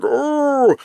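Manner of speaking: high, drawn-out mock "oh"; put-on high-pitched ooh; mock awed reaction. Mimics others' reaction to it.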